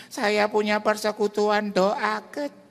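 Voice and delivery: put-on high, slow old lady voice; lightly voiced elderly widow; old woman caller. Old widow phoning the pastor, high and drawn out.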